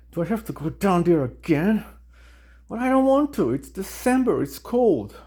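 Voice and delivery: high, reluctant whining protest; exasperated Jesus. Acted. Jesus balking at coming back to earth, losing patience. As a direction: plead and complain, voice jumping up. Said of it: "would eventually lose his patience".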